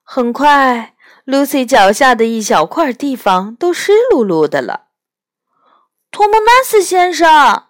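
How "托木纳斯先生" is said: high, loud shout of his name; shouting in his ear. A narrator's reading. Lucy shouting into the faun's ear, loud and high. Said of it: "在他的耳边大喊着".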